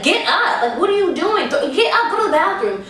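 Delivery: high, loud urgent commands; urgent yelling. Retold or imitated. Ashley yelling at the sick girl to get up, high urgent yell. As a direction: order her up, high and loud.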